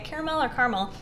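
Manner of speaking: first "Caramel" as CARE-uh-mel, then "caramel" as CAR-mul; contrasting pronunciations. Shown as a demonstration. Vlogger asking viewers which is right, "Caramel" as care-uh-mel, "caramel" as car-mul. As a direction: set three-syllable care-uh-mel "Caramel" against two-syllable car-mul "caramel". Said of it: "How do you say it?".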